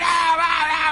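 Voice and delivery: imitating the staff's high cheering shout; a lightly done crowd roar; mock crowd yell. Preacher mimicking store workers cheering. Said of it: "empezaron a gritar".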